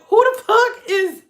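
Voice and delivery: high, loud, slow shocked question; shocked disbelief. Retold or imitated. Sister reacting to her angry brother, high shocked disbelief. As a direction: blurt it out stunned. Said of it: "I was just like".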